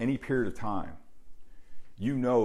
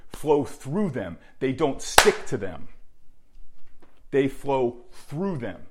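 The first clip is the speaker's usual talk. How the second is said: slow emphatic preaching; 3 semitones higher, 3 dB louder, slower than usual. Real, not acted. Coach preaching toughness.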